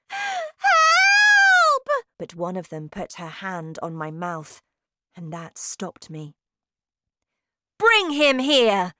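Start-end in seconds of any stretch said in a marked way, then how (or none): 0.1-1.9 very high, long, loud scream for help. A narrator's reading. Held boy cries for rescue, piercing scream. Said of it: "I screamed again". 7.8-8.9 high, loud witch's order; imperious shouted summons; commanding shout. A narrator's reading. Grand High Witch summons captured boy, loud shout. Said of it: "shouted the Grand High Witch".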